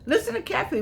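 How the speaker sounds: high, laughing call to listen; laughing, high-pitched listen up; playful call for attention. Real, not acted. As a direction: pitch it way up, laughing, to grab the room. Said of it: "for the people in the back".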